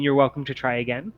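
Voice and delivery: normal talking voice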